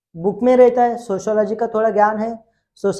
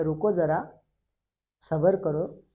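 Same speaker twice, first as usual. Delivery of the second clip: softer, hushed calming of an anxious crowd; calm reassurance; 5 semitones lower, 8 dB quieter than usual. Acted. Comte soothing complaining townsfolk, soft and calm. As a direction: quietly settle them down, unhurried.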